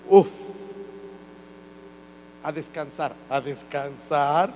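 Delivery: low, slow mock sigh of relief; tired teacher. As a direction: drag it out like a worn-out teacher. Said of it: "las maestras dicen". (maestra cansada, lenta y grave)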